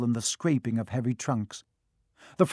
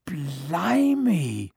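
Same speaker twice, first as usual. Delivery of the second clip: amazed; 3 semitones higher than usual. A narrator's reading. Twin recognising Harry.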